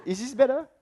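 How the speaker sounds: put-on high, loud girl's voice; imitating a girl. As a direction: pitch it way up as the girl asking. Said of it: "the girl will say".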